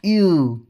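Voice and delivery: यू rounded and pressed lightly from the throat; German umlaut u. Shown as a demonstration. Teacher demonstrating the umlaut ü to students. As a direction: hold the lips round for यू. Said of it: "हल्का सा इतना करना था हमने गले पे".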